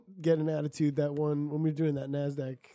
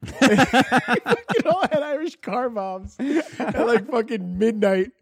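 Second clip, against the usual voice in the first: laughing; 7 semitones higher, 7 dB louder, much slower than usual. Real, not acted. Laughing retelling.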